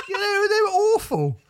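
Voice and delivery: high squealing words through laughter; cry-laugh it out; laughing hard. Real, not acted. Host squeals through laughter about [name]'s band. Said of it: "laughing hard".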